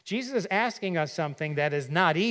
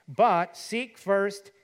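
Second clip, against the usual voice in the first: But seek first, slow, each word pressed; stress on first; 4 semitones higher, 5 dB louder, much slower than usual. Acted. Preacher stressing seek first to the congregation, slow. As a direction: lean on first, drawn out. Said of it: "that word first there is not of a series".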